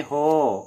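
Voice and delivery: हो said with a rounded o vowel in place of हा; Assamese pronunciation. Shown as a demonstration. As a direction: say हो as Assamese does. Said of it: "ये हा हिंदी में".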